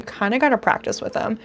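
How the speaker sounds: ordinary talk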